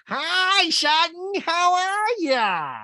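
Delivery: shrieked greeting across a room, very high; Rinna. Retold or imitated. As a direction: yell a drawn-out hello in mocking imitation. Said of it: "Rinna yells from across the room".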